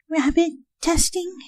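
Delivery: high, soft alarmed question; Fluttershy alarmed. A narrator's reading. Fluttershy asks Twilight about rabbit testing. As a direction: gasp it out timidly.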